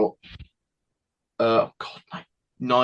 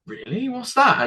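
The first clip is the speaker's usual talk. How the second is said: put-on childlike surprise; 13 semitones higher than usual. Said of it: "I was like".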